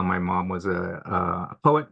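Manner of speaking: ordinary talk, nothing marked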